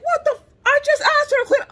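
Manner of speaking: high, loud indignant outburst; imitating an exasperated dad, shrill and loud; outraged dad squeal. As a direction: squeak it in disbelief.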